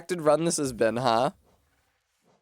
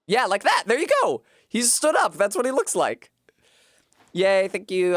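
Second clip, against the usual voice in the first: high, loud delighted cheers; excited cheering; 6 semitones higher, 3 dB louder than usual. Real, not acted. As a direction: cheer it on gleefully. Cheering on a moment in game.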